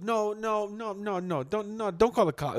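high, mock-panicked begging; imitated pleading girl. Girl begs him not to call.

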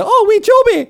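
high, loud Scottish mum voice; imitating mum. Daughter imitating her mum tossing pine cones, high and loud. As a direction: squeak it like a fond mum. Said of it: "chuck them and say".

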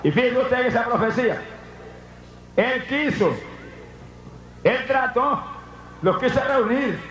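a high shout of a preacher; preaching at full force. Acted. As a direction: preach the rejection with rising fire.

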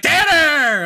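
high called-out name, lightly voiced; a hint of Robin Williams. Dream Robin Williams greets the dreamer, lightly voiced high call. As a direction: call the name like a cheery ghost. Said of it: "he's like".

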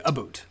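"a boot" with a long oo. Shown as a demonstration. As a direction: say "a boot", vowel like boot. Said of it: "never heard a Canadian in my life say".

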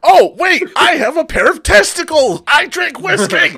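loud, high mocking rant; swearing tirade. Real, not acted. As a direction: sneer and shout it out. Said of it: "brought that out of me".

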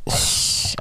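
voiceless hiss of a tired voice; worn-out voice demo; raspy lost voice. Shown as a demonstration. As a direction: show a voice gone to air. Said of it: "my voice is like".

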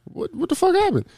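high-pitched, alarmed retold "what the fuck"; startled question. Son reacting to news about the neighbor, startled. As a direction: blurt it out high in disbelief.

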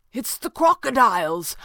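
high, loud cry of discovery; excited cry. A narrator's reading. Max spotting where the water comes from, excited cry. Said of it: "Max cried excitedly".

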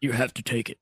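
low, quiet mimicry of a wrecked voice; blown-out sergeant's throat. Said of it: "his throat was permanently blown out".

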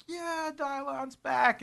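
high, slow, drawn-out cheer; mock cheer. Mimicking a crowd cheering Dylon's return, high and stretched.

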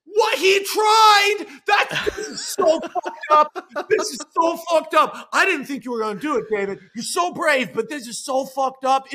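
high, loud, half-laughing panic; frantic disbelief. Acted. Jack reacting to David surviving the fall, frantic. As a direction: freak out at the sight.

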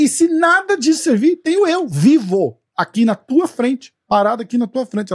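exasperated yell at the clerk; retold outburst. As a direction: shout it like you can't believe them. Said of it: "Eu falei".

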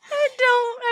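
laughing. Real, not acted. Laughing reply.

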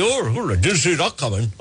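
rough attempt at a Scottish accent. Host mimicking the Scottish police, hammed-up Scottish accent. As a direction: imitate Scottish police with a shaky-grasp Scottish accent. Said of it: "work on my Scottish accent".

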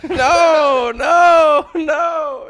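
high, laughing shouts of no; loud protest. Real, not acted. Actor refusing after failed accent attempt, high loud protest. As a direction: wail each no loudly, high and drawn out.

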